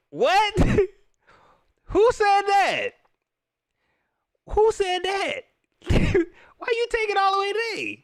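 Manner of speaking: high squeaky laughing disbelief; can't believe he asked that; laughing in high-pitched shock; incredulous laugh. Real, not acted. Laughing disbelief at reporter's question.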